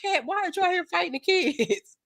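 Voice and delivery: mock disbelief. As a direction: tease him, pitch shooting up in disbelief. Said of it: "I was like".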